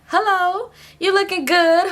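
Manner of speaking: high-pitched imitation of an older woman; high, put-on flirty hello; mimicked greeting. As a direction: coo a high hello to an old flame. Voicing older woman greeting him.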